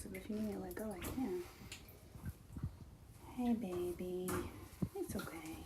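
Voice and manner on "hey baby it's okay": hushed and gentle; soothing a scared animal. Real, not acted. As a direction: soothe her softly, nearly whispering. Soothing the chicken.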